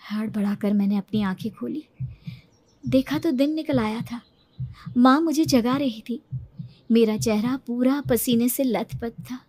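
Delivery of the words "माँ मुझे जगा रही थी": usual speaking voice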